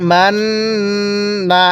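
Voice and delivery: মান্না with the ন্ন held long as a nasal hum; slow মান্না, humming the ন্ন through the nose; nasal ghunnah. Shown as a demonstration. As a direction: let the ন্ন of মান্না ring in the nose before the last না. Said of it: "নাকের বাসি দ্বারা গুনাহ হবে".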